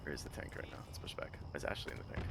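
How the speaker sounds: hushed unvoiced questions; whispering. Real, not acted. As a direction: whisper it under your breath. Quiet asides while playing.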